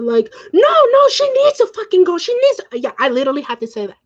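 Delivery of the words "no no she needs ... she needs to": very high, loud re-enacted outburst; acting out a furious, insistent protest; enraged. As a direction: cut in frantically, voice shooting up. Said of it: "I was so much enraged".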